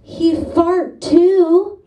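put-on toddler voice. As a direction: say it like a solemn toddler. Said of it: "looks at me and goes".

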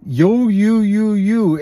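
retold blaming. Addict in denial pointing one finger at you, loudly accusing. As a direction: hammer each word like pointing a finger.

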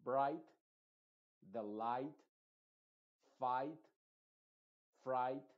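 "bright", "light", "fight", "fright" with "ai" and a crisp final T, GH silent. Shown as a demonstration. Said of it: "en los ejemplos diríamos". ("bright", "light", "fight", "fright" con "ai" y T final, GH muda)

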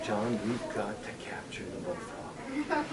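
slow, breathy whisper. Retold or imitated. Sister urging her brother to catch the frog, whispered. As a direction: whisper the plan urgently. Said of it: "and she said".

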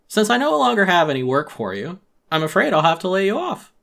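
smooth-talk the firing politely; oily raccoon boss laying him off; Tom Nook voice. Acted.

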